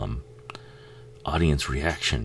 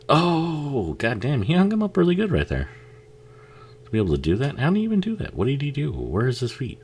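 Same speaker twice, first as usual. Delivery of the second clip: amazed; 12 semitones higher than usual. Real, not acted. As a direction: gush at the shocking shot. Amazed at movie scene.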